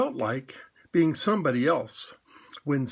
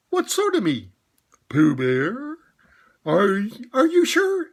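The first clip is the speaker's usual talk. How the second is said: much higher, startled rabbit voice; surprised Rabbit; 7 semitones higher, 5 dB louder than usual. Acted. Rabbit amazed to hear Pooh is Pooh. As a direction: blurt it out in disbelief. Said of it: "still more surprised".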